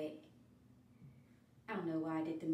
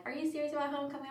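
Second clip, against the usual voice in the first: quick high quote; 7 semitones higher, much faster than usual. Retold or imitated. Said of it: "they're like".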